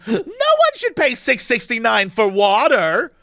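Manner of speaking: mock outraged whine, high and drawn out; hammed-up slow protest from a tipsy woman; indignant drunk woman. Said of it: "the woman next to her goes".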